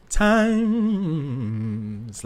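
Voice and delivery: "Times" sung high, closing onto a long hummed m; nasal mm ending. Shown as a demonstration. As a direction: land "Times" on a held nasal m. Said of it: "keeping it on the mm".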